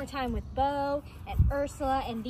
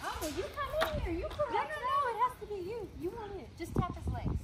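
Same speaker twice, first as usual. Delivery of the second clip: coaxing pet talk; 7 semitones higher, 8 dB quieter than usual. Real, not acted. Coaxing alpaca to correct.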